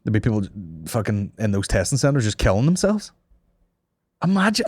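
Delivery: usual speaking voice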